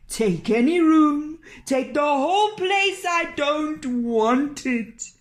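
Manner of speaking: high, loud, desperate cry, slowing at the end; frantic innkeeper. Acted. Innkeeper pushes the inn on Nils, loud and frantic. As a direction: throw the whole place at him, panicked.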